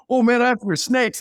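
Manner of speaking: high, loud, excited confession; eager player. Retold or imitated. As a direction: blurt it like a keyed-up teammate. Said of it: "He's like".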